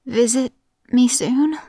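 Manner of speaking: high, halting plea; pleading ailing mother. A narrator's reading.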